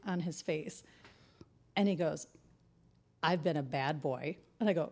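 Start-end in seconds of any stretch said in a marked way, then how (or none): 3.2-4.4 low, slow, soft imitation of a man; mock naughty confession. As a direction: drop deep and draw it out. Boyfriend's kinky confession retold.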